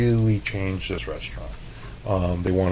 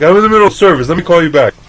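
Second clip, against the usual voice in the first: busy reply; 9 semitones higher, 10 dB louder, faster than usual. Retold or imitated. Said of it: "I'm like".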